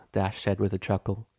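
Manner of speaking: plain reading voice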